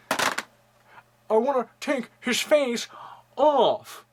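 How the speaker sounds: high, slow, menacing quote; movie villain voice. Retold or imitated. Vlogger quoting Face/Off in a put-on villain voice. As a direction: savor each word like a movie villain.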